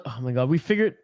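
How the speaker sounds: ordinary delivery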